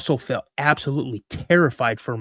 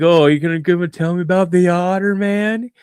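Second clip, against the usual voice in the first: higher, louder mocking challenge; cocky teenager; 4 semitones higher, 4 dB louder than usual. Sixteen-year-old challenges dad's friend, cocky mock. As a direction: taunt it, pitch climbing to the end. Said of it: "I'm kinda cocky".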